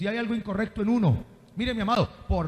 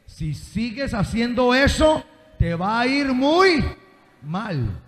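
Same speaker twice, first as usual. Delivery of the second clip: stern mother's warning; 5 dB louder, slower than usual. Acted. Mother telling the child what she really means, stern warning. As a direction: warn the child in earnest, drawn out. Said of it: "la intención del corazón, ¿cuál es?".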